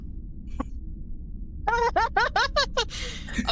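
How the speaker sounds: a helpless squealing laugh; high laughing fit. Real, not acted. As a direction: break into high giggles.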